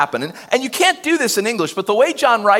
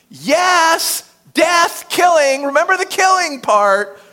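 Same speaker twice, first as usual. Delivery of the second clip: ordinary talk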